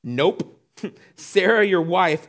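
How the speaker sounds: loud, firm refusal with a laugh in it; God's blunt nope. Retold or imitated. God refusing Abraham's plan, loud and firm. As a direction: cut him off with a loud nope. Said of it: "God says".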